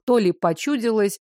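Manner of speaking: ordinary delivery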